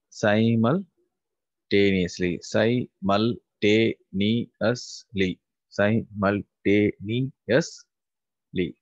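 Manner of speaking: drawn out syllable by syllable, very slowly; teacher spelling out Heterogeneous slowly for the class; slow syllabified. Shown as a demonstration. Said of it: "So it is six syllable".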